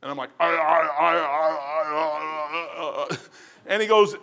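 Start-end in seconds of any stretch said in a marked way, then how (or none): 0.4-3.2 stuck on I, can't get the words out; a caught student stammering; flustered stammer. Retold or imitated. Stammering under questioning.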